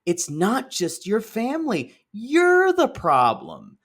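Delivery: the penny drops mid-sentence; shocked realization. Retold or imitated. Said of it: "I went, gasps".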